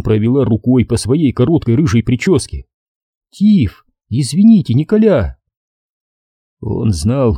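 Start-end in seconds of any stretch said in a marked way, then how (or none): 3.3-5.4 девичий голос. A narrator's reading. Девушка извиняется.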